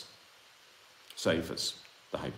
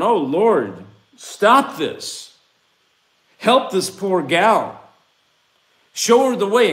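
pleading prayer; 7 semitones higher, 8 dB louder, much slower than usual. Retold or imitated. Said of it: "I'm going".

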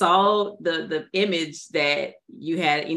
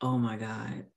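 awed hush; 6 semitones lower, 8 dB quieter than usual. Retold or imitated. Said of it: "I was like".

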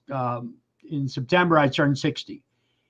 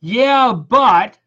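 higher, louder mock of a dismissive mom; 6 semitones higher, 6 dB louder than usual. Son mimicking mom's repeated dismissal, louder and higher. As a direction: brush him off again, a bit louder and higher. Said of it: "She goes".

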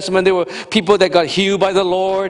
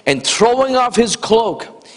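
normal talking voice

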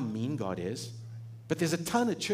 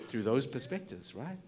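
usual speaking voice

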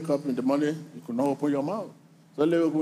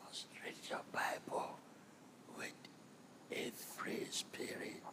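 whisper; 23 dB quieter than usual. Acted. Preacher whispers the instruction.

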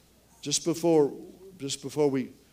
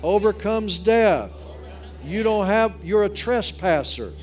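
loud, forceful rebuke; preaching at full force; 7 semitones higher, 6 dB louder than usual. Acted.

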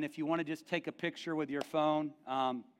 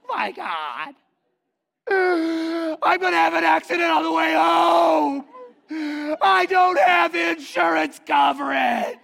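high, loud panicked wailing; panicked shout; 13 semitones higher, 14 dB louder, much slower than usual. Retold or imitated. Friend refusing a new car, panicked high shout. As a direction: shriek it like a man terrified of the gift. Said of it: "And Ashton's like".

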